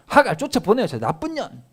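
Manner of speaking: Sarah shrieking. Acted. Sarah demanding Abraham send Hagar away, loud angry shriek. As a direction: spit it out like a furious wife. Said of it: "막 이러는 거예요".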